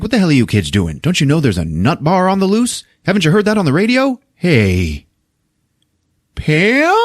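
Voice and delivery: a touch of an indignant beat cop, pitch climbing; lightly voiced cop. Beat cop warns kids about a nut bar, mock indignant. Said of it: "by a cop".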